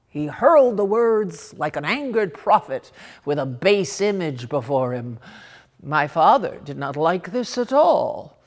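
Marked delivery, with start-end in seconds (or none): none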